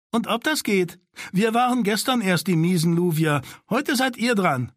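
high, taunting comeback; mocking retort. Sina taunts Daron that it is his turn. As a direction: brush off his protest with a sneer.

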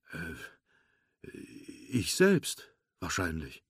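hesitant, puzzled answer that tails off softly; hesitate, rise in doubt, then drop away; unsure, groping for the answer. A narrator's reading. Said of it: "den die seltsame Frage seines Freundes verwirrte".

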